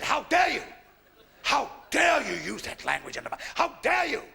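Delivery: put-on a Mother Superior telling off a young nun; outraged scolding. Mother Superior scolding the nun for swearing, loud and outraged.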